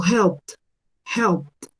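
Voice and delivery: HELPED as one syllable, "helpt"; T ending. Shown as a demonstration. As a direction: sound a T straight after the P in "helped". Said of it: "hago sonar una T".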